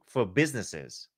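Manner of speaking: usual speaking voice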